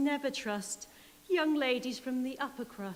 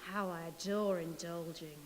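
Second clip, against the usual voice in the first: lower, softer, savouring greed; greedy wolf; 7 semitones lower, 6 dB quieter than usual. Acted. Wolf savouring his appetite, low and soft. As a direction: purr it lingering over the food.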